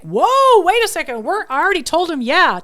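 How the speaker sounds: husband cutting in loud and high; a startled husband objecting loudly; husband's startled protest. Retold or imitated. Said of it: "And my husband's like".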